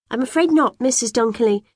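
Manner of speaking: high, prim and very proper; best polite voice. A narrator's reading. Girl refusing the neighbour's tea in her best, prim voice. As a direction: put on your most polite manners. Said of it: "I say".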